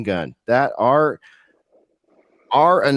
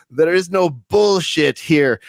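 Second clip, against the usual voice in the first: lightly imitated guest, higher and slower; a hint of impersonation, drawn out and raised; Mystical Pharaoh; 7 semitones higher, slower than usual. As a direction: quote it slowly, pitched up, as a light impression. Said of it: "I believe his first comment".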